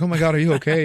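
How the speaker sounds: mock worried cry; alarmed concern. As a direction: gasp it out like everyone rushing over. Everyone rushing to fallen guy.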